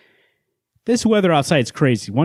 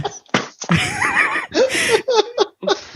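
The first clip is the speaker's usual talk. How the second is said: long helpless laugh, pitched way up; real laugh at the rocks joke; big high laugh. Real, not acted. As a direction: burst into a high laughing fit.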